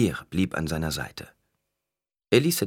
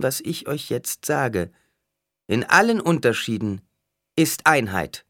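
solemn intoning; 8 semitones higher, 3 dB louder than usual. A narrator's reading. Alia prophesies to the temple crowd, solemnly intoning. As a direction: proclaim it as prophecy, pitched high. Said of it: "intonierte Alia".

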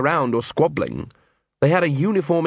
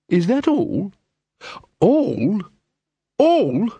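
high, rising disbelief; outraged disbelief, climbing; incredulous; 8 semitones higher, much slower than usual. Acted. Incredulous exchange.

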